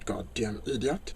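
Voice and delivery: muttered insult. Acted. Mutters insult after drill.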